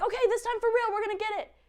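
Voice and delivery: high, mock determined villagers; rally the town, hammed up; townspeople. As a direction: townspeople coming back a second time, mock determined and high. Townspeople rush again.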